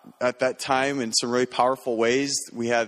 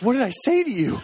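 baffled; 11 semitones higher than usual. Retold or imitated. Confused exchange with fiancée.

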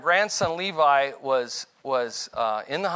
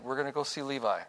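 real nice; 3 semitones lower, 9 dB quieter, faster than usual. Retold or imitated. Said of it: "I said".

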